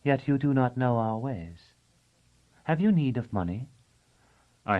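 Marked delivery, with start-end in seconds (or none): 0.0-3.7 cooing voice. A narrator's reading. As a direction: coo it gently like a hostess. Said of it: "in her cooing voice".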